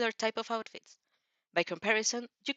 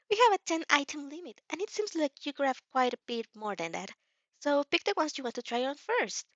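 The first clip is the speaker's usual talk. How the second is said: a higher, cheerful saleslady; bright customer-service voice, a bit higher; friendly shop assistant; 6 semitones higher than usual. A narrator's reading. The saleswoman offers the customer a fitting room, cheery.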